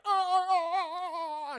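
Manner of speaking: high sing-song dying moan; high mock moaning. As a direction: wail it out high, hammed-up dying. Possessed mother dying.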